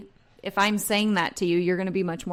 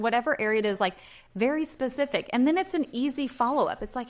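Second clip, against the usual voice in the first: ordinary delivery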